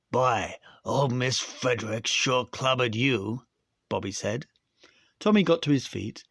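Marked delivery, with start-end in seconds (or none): 0.1-3.5 put-on slower, American accent for the boy; American accent retake. Shown as a demonstration. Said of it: "probably American".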